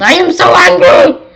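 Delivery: high, very loud outburst; angry yell. Acted. Angry Baron von Grump at the end of his patience, angry yell. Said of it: "and yelled".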